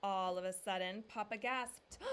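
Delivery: ordinary delivery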